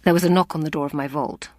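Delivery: usual speaking voice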